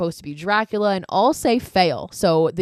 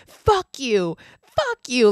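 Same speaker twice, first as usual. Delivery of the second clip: drawn-out, higher-pitched retold outburst; mock cursing; 5 semitones higher, much slower than usual. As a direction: imitate someone swearing pointedly at the screen. Retold man cursing at cheering children.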